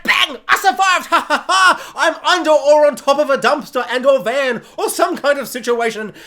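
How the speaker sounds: high, loud gloating; mock triumphant survivor. Survivor bragging loudly and high, hammed up. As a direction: brag about surviving, hammed up. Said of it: "they're like".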